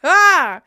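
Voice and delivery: high, loud "ah" cry; sudden high-pitched exclamation; loud outburst. Real, not acted. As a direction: let out a short loud yelp. Playful outburst after imagining crying.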